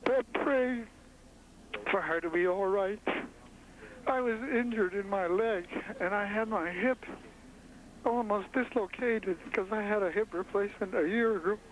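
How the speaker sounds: real distress, high strained voice struggling to go on; shaken, choking up. Real, not acted. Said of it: "I'm pretty shaky right now".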